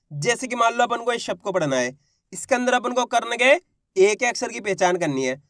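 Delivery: ordinary talk, nothing marked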